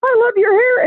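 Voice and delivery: very high, loud squeal of delight; delighted stranger squealing at the hair; excited squeal. Retold or imitated. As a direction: squeal it like you can't contain yourself. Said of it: "She goes".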